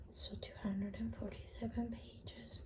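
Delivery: soft whisper; library whisper. Real, not acted.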